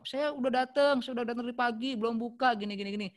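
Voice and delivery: high, loud irritated complaint; angry complainer. Shown as a demonstration. Upset caller complaining about waiting since morning, loud and high. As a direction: complain like a fed-up parent who waited since morning. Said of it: "Misalkan dia".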